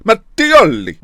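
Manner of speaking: high, loud, hammed-up Italian accent; exaggerated Italian accent. Gamer showing off his Italian, exaggerated Italian accent. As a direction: punch the name out like a theatrical Italian. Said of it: "I'm a lot more Italian".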